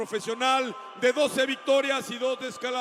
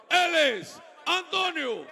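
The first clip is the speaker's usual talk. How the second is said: long stretched shout of the fighter's name, higher and louder; 5 semitones higher, 6 dB louder than usual. Real, not acted. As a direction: hold the name out to build the crowd. Announcer calls out fighter's name.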